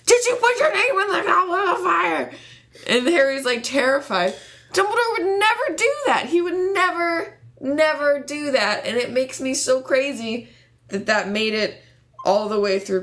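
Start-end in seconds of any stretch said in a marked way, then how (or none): 0.0-2.3 high, loud frantic shout; mock the panicked film Dumbledore. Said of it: "comes running ... shakes Harry". 4.7-8.8 high, fervent protest; indignant. Real, not acted. Passionate fan rant.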